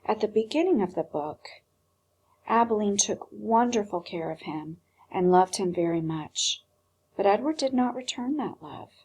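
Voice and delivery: usual speaking voice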